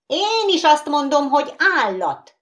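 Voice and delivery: high, loud excited call; excited shout. Acted. Skittle butting into the argument, shouting. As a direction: blurt it out. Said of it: "kiáltotta".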